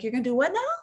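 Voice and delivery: voicing fear's shocked outrage; incredulous fear voice. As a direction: play fear aghast at the plan. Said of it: "Your fear is like".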